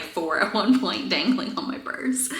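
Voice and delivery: ordinary delivery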